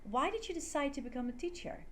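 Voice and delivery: "Why" high, "teacher" falling; falling intonation. Shown as a demonstration. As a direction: start high and let "teacher" drop. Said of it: "end with a falling intonation".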